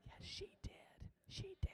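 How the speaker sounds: hushed joking confession; whispered aside. Real, not acted. Pastor whispering a joke about his wife. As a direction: drop to a whisper, teasing.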